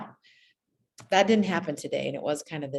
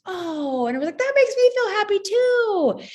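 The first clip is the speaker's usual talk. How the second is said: much higher, louder gushing voice; delighted teacher; 7 dB louder than usual. Retold or imitated. Teacher responding to boy's share, high delighted gush.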